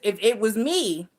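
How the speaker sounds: usual speaking voice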